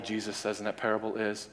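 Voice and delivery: ordinary talk, nothing marked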